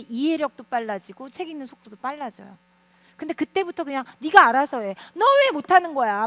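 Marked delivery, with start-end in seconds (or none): 4.2-6.3 loud mock scolding of an exasperated parent; imitated irritation. Annoyed parent to child struggling to read, mock irritated scolding. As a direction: snap it out like a fed-up parent. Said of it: "막 이러면서 막 짜증내셨던".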